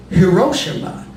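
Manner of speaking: Hiroshima as huh-ROH-shuh-muh, stress on "ro"; altered pronunciation. Shown as a demonstration. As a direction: punch RO in Hiroshima and swallow the shi. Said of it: "is our collective unconscious altering language".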